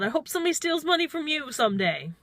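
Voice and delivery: 높고 빠르게 쏘아붙이는 저주; 분해서 내뱉는 악담; mean parting shot. Retold or imitated. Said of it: "I said something mean".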